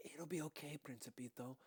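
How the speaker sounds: softly whispered reassurance; quiet whispered comfort. A narrator's reading. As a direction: hush it to calm the little one.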